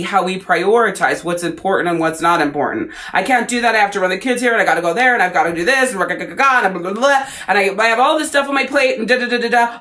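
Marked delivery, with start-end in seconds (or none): 3.1-9.8 fast, higher whiny list of excuses; rattled-off mock excuses. Mocking someone too busy for self-care, rattled off fast and whiny. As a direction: gabble the excuses to mock them.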